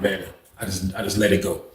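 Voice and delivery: soft, low resigned sigh-voice; softly giving up; resigned mutter. Retold or imitated. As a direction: let it drop quietly. Resigned to himself.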